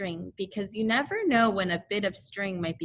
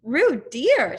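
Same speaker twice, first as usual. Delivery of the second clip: reproachful; 4 semitones higher, 9 dB louder than usual. A narrator's reading. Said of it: "said Kanga reproachfully".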